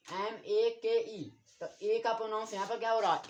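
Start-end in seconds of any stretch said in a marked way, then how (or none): 0.1-1.3 letters spelled slowly one by one; slow spelling out. Shown as a demonstration. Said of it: "यहाँ पर क्या है".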